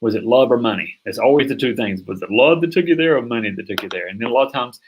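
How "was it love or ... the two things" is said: usual speaking voice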